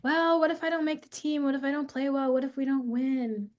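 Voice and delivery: lightly voiced nervous child; worried child. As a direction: voice the son's worries. Son listing fears.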